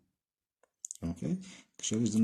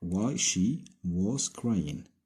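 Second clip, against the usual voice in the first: each word stretched and spaced; very slow dictation; much slower than usual. Shown as a demonstration. Teacher giving the reported-speech answer to the class, very slow. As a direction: spell it out slowly for learners. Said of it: "دونك".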